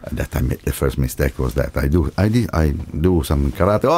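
ordinary delivery

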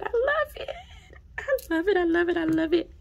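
high, repeated squeals of love; gushing. Real, not acted. Vlogger repeating her love for the pen, squealing. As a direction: chant it out in glee.

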